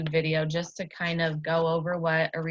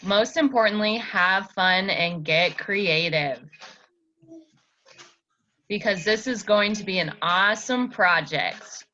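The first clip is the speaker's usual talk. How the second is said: reading the video aloud. Acted. Tutor reads a silent video's captions to her student, upbeat presenter voice. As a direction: read it like an excited video host. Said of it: "I'll read it to you 'cause she doesn't talk at all".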